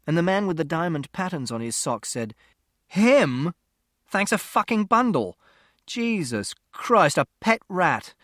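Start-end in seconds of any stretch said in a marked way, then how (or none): 2.9-8.1 higher, louder sarcastic grumble; a touch of furious disbelief; exasperated rant. Acted. Man ranting to the lady about the boy and his rat, exasperated.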